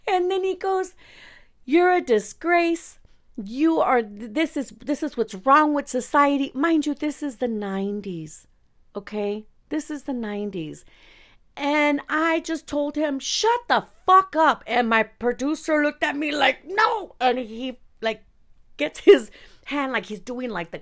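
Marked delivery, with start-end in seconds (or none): 1.6-6.5 higher, louder mock scolding; imitating an outraged man's rebuke; scolding tirade. Host berates the young singer as a disgrace, scolding. Host's insult acted out in quarrel. 13.2-14.6 high, loud, drawn-out retold outburst; angry retort. Young singer silences the host who insulted her, furious. 16.7-17.2 alarmed plea. Retold or imitated. Producer's alarm acted out.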